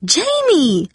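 high-pitched cry of alarm; startled cry. A narrator's reading. Darcy sees her sister sneaking in, startled high cry. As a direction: cry out the name in shock. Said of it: "Darcy cried, jumping up".

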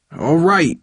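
high, frightened giving in; shaky voice. A narrator's reading. Son yields to his furious father, shaky voice. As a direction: give in, shaken. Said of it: "said in a shaky voice".

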